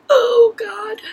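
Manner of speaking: high, loud cry of oh God; alarmed cry. Retold or imitated. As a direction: cry it out in sudden panic. Told she needs a bed now.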